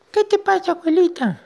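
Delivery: very high, squeaky ant; tiny little voice. Acted. Unseen ant asking grandma what's wrong, tiny squeaky voice. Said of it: "sentía una vocecita".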